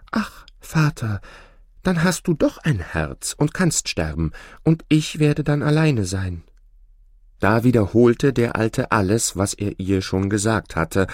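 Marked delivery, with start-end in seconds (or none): 0.1-6.4 saddened bride. A narrator's reading. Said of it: "sehr betrübt und sprach".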